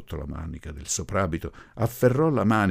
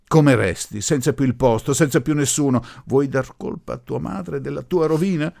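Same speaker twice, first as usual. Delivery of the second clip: loud, higher, urgent reproach; heated scolding; 8 semitones higher, 7 dB louder than usual. A narrator's reading. Professor warning the orphaned bride of ruin, heated scolding. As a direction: hammer the questions at the listener.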